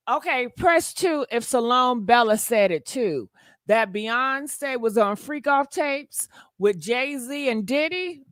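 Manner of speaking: normal talking voice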